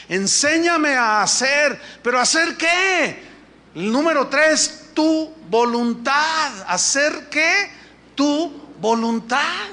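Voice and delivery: loud, high, slow pulpit preaching; fervent preaching. Acted. Pastor to congregation, loud slow fervent preaching. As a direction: hammer the verse home at full force.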